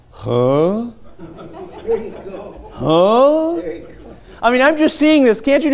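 hammed-up baffled disciples, the second huh climbing high. Preacher acting the confused disciples to his church, baffled. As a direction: play a clueless disciple who just doesn't get it.

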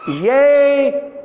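high shouted yea, half laughing; old father exclaiming in dismay. Acted. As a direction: cry it out in shock. Isaac's dismayed exclamation acted out.

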